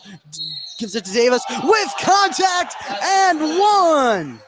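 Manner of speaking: excited call. Real, not acted. And-one foul call on Davis.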